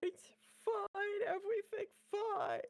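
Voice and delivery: very high, squeaky false reassurance; flustered squeak. Real, not acted. Insisting it's okay after the art hits stream, flustered squeak. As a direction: squeal it in a high, strained pitch.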